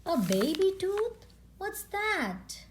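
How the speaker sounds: high wondering questions; puzzled child. Acted. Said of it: "asked Peppa".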